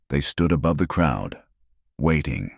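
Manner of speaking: ordinary delivery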